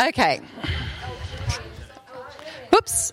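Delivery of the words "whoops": sudden high yelp of surprise; real little jolt of surprise; startled whoops. Real, not acted. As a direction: catch yourself mid-stumble. Near stumble during the session.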